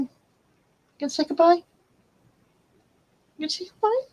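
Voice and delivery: high coaxing voice; high sing-song goodbye; pet voice. Real, not acted. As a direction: coax the cat to say goodbye. Coaxing the cat.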